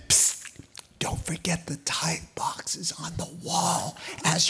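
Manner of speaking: hushed, conspiratorial aside; sly whisper. Retold or imitated. Priest slipping the Danites a reminder, sly whisper. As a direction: whisper it like a secret kickback.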